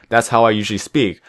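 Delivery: ordinary delivery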